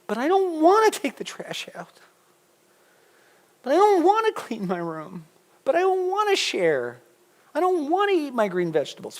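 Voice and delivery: put-on high whining child voice; mock a kid who won't do chores; whiny kid. Preacher mimics kids complaining.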